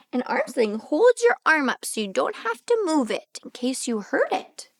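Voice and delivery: ordinary delivery, nothing marked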